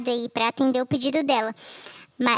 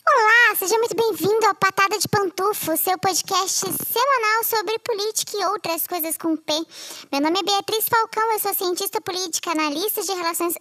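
bright, higher announcer greeting; podcast host intro; 6 semitones higher than usual. Acted. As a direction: open the show like a host. Formal podcast opening.

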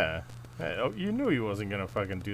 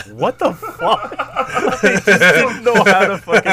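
laughing disbelief; 10 semitones higher, 13 dB louder than usual. Retold or imitated. Reacting to [name]'s assassination, loud laughing disbelief. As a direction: draw it out while laughing in shock.

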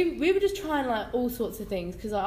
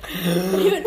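ordinary talk, nothing marked

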